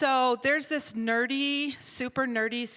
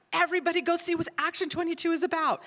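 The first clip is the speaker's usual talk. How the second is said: higher-pitched sarcastic announcement; mock agreement; 6 semitones higher, 4 dB louder than usual. Answering the online critic, mock agreement. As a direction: play along, pitching it up.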